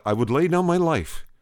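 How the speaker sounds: retold fervent vow, pitched high; impassioned vow. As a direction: let the voice climb in earnest pleading.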